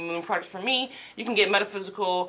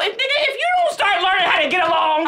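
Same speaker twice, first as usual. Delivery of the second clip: angry; 8 semitones higher, 3 dB louder, faster than usual. Real, not acted. Host snaps at guest.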